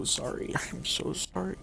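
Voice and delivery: soft, breathy laugh through the apology. Real, not acted. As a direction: laugh while saying sorry under your breath. Laughs during the repeated apology.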